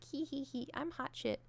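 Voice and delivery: high giggly smug self-quote; smug giggle. Retold or imitated. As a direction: giggle like you think you're hot.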